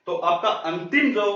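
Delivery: normal talking voice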